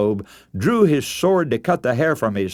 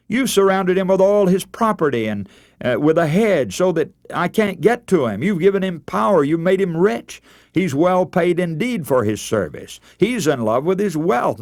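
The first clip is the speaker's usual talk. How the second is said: lightly voiced accusing Satan, pitched higher; accusing challenge; 6 semitones higher than usual. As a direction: press the accusation, mildly heated and higher. Satan challenging God about Job.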